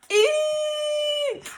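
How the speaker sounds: very high frightened eek; squeaky scared lion. Acted. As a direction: let out a tiny terrified eek. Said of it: "let out a".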